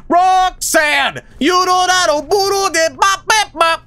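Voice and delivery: high belted [name] impression. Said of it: "as [name] would say it".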